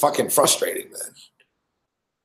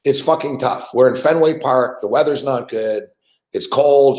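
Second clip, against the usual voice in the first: ordinary talk, nothing marked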